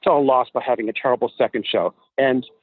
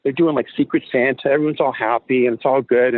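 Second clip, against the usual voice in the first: usual speaking voice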